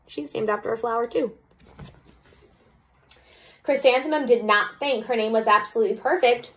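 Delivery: ordinary delivery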